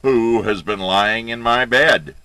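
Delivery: very loud, booming bear outcry; great big bear voice. A narrator's reading. Big bear looks at his bed, loud great big voice. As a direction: roar the question as a huge bear. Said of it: "in his great big bear voice".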